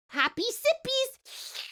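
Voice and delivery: sing-song cutesy cheers; cutesy toast. Real, not acted. Cutesy toast over tea.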